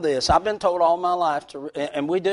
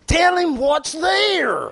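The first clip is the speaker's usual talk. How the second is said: shouting; 11 semitones higher, 7 dB louder, much slower than usual. Acted. Exhorting congregation.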